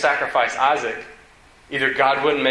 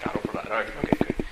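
soft, whispered reluctant acceptance; mock-meek boy Isaac; 4 semitones lower, 8 dB quieter, much faster than usual. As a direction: go along with it under your breath. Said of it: "Isaac's probably like".

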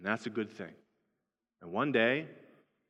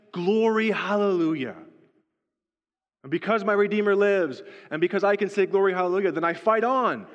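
higher, louder, rousing preacher's cry; preaching at full force; 6 semitones higher, 5 dB louder than usual. Acted. Pastor rousing the congregation to glory, full-force preaching. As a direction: build the congregation into triumphant worship.

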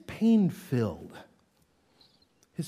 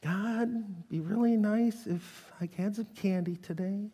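mock shallow prayer; 3 semitones higher, 7 dB quieter than usual. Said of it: "just, you know".

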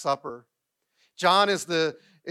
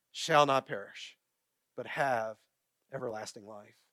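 scripture recitation; 5 semitones lower than usual. Acted. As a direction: recite it solemnly, phrase by phrase. Recites a Bible verse to congregation.

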